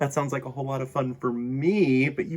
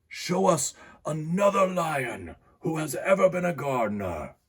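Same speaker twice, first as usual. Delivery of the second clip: put-on happy lion with a higher French-accented voice; 6 semitones higher than usual. A narrator's reading. Happy lion speaking of his gardener son, French accent. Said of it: "I apologize for my French accent".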